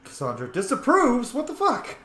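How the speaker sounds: high, loud disbelieving cry; sudden shocked what-the-hell; shocked outrage. Real, not acted. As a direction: blurt it out, stunned and indignant. Sudden disapproval pops up in game.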